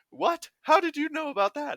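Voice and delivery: high, astonished question; shocked. Acted. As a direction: gasp it out in disbelief. Paladins shocked at god's knowledge.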